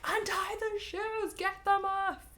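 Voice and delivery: put-on high, slow frantic girl voice; Annabeth urgently. Annabeth yelling at Grover to kick the shoes off, high and frantic. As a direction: shout it like Annabeth chasing after Grover. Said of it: "Annabeth's like".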